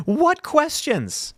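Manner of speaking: high, slow disbelief; drawn-out mock bewilderment; incredulous. Real, not acted. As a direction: scoff at it, baffled. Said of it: "mocking a headline".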